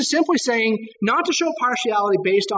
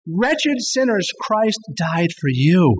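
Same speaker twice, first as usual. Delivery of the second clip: slow emphatic; 2 semitones lower, much slower than usual. Acted. Sermon emphasis on you.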